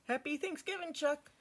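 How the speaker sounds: a lightly voiced tomboy girl; Peppermint Patty voice. A narrator's reading. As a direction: lift the voice up for Patty.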